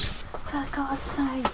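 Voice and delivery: slow, very soft exasperated mutter; frustrated mutter. Real, not acted. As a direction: grumble it under your breath. Frustrated mutter while searching.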